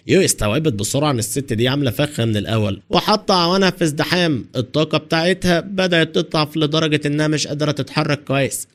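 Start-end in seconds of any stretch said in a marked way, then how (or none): none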